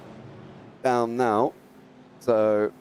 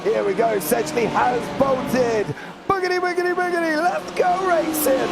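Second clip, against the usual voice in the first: race start call; 13 semitones higher, 3 dB louder than usual. Real, not acted. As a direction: bellow the start like a race announcer.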